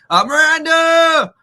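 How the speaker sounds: high, loud greeting call; excited shout. Real, not acted. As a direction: belt out her name. Host greets guest Miranda.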